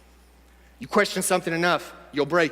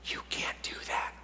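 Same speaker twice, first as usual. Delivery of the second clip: hushed, whispered voice in the head; eerie, breath-only whisper; whispered inner doubt; 15 dB quieter than usual. Acted.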